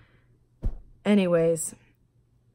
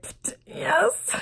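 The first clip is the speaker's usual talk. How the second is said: a forced, scoffing yes, voice jumping much higher; 12 semitones higher than usual. Retold or imitated. Crying passenger brushing off the Uber driver's concern with a scoff. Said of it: "And I'm like".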